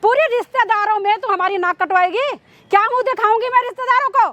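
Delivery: outraged mother's tirade; scornful, indignant scolding; angry scolding. Acted. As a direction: berate him with indignant questions. Acted mother berates daughter.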